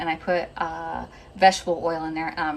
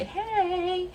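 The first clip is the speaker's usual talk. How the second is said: high, drawn-out hey; playful high-pitched "hey"; cheery greeting; 13 semitones higher than usual. Shown as a demonstration. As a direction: sing out a long, high hello. Models the greeting wanted from viewers.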